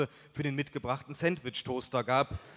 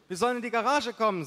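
higher, quicker mother voice; voiced mother; 8 semitones higher, 4 dB louder, faster than usual. A narrator's reading. Mother passing on the call to the garage, higher quick voice. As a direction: pitch it up and hurry it along.

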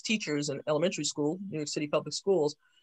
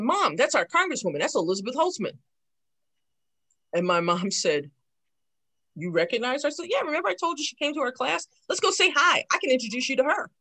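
put-on excited kid; 7 semitones higher than usual. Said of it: "And I said".

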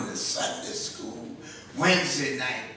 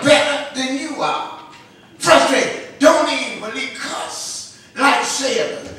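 raised, punchy pulpit cadence; impassioned preaching; 10 semitones higher, 12 dB louder than usual. Real, not acted. Preacher contrasts churchgoers with unbelievers, impassioned and loud. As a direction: drive each phrase out with loud force.

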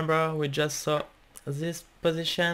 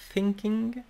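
higher, mock French "thinking"; French accent; 5 semitones higher than usual. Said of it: "sorry for my bad French accent".

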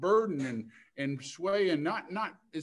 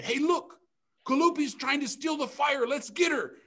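high, loud alarm from a sky person; alarmed shout; 10 semitones higher, 8 dB louder than usual. Acted. Sky person spots the thief.

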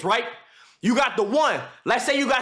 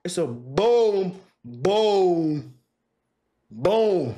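slow, drawn-out low boom; lower, slow rhythmic boom boom boom; slow grinding boom; 4 semitones lower, much slower than usual. Shown as a demonstration. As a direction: stretch each boom slowly, lower. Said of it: "You gotta grind that shit on".

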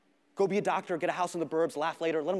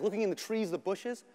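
usual speaking voice

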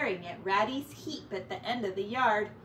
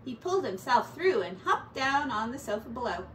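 ordinary delivery, nothing marked

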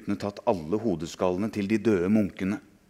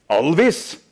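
calling out; 7 semitones higher, 7 dB louder than usual. Acted. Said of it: "sa han".

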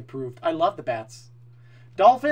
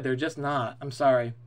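ordinary talk, nothing marked

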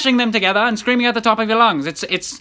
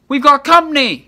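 higher, louder scolding burst; exasperated outburst; 5 semitones higher, 4 dB louder than usual. Acted. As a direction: blow up at him. Parent scolds son about noise.

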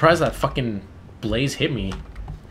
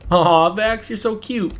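cooing; 11 semitones higher, 4 dB louder, slower than usual. Real, not acted. Cooing at a character on screen.

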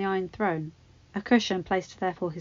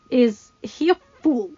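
slow, raised sneer; contemptuous king; 4 semitones higher, 8 dB louder, slower than usual. Acted. Joffrey sneering about Tarly, contemptuous. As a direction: scorn it.

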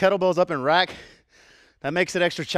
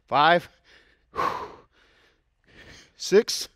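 counting out reps; 2 semitones higher, much slower than usual. Real, not acted. Coach counting reps during set.

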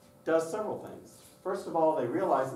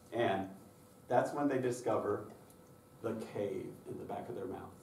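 normal talking voice

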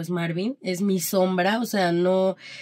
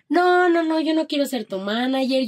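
higher, loud opening protest easing off; mock emphatic refusal; 7 semitones higher, 4 dB louder than usual. Husband refusing the manager job, retold as a high, emphatic protest. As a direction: imitate a partner protesting hard at the start.

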